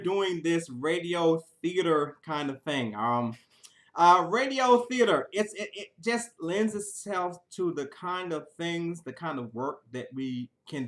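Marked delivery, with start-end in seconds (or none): none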